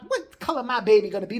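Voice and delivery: normal talking voice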